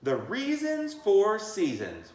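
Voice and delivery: drawn-out title announcement; announcing title. Acted. Reading a book title.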